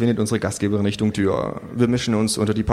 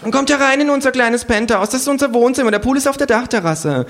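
very high, loud party-host voice; gushing hostess; 4 dB louder than usual. A narrator's reading. Hostess showing guests her penthouse, gushing high. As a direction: show off the home in a high voice.

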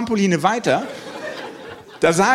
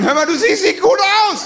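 high-pitched mock holler to a friend; comically raised shout from afar; calling out; 14 semitones higher, 4 dB louder than usual. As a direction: call it across the trampolines, voice pushed high. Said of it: "irgendwann rief er dann".

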